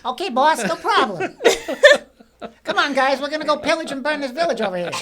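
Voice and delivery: high, loud wiseguy voice; hammed-up high-pitched gangster sidekick; Joe Pesci-like henchman. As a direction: play an eager goon rallying the crew, half laughing. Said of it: "There is a Joe Pesci in you somewhere".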